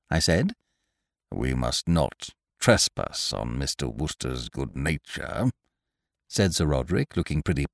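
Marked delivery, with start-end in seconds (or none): 1.3-5.5 low, slow and stuffy; a disapproving elderly doctor; pompous old gentleman. Acted. Sir Roderick speaks.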